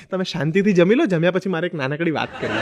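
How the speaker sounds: soft, sweet mock wife; wife's sweet warning. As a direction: lull him, then drop the threat. Wife voiced sweetly, ominous.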